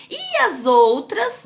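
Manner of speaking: normal talking voice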